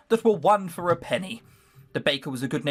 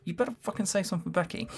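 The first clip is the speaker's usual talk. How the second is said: urgent aside; 3 semitones higher, 7 dB quieter, faster than usual. Real, not acted. Player hissing aside to friend.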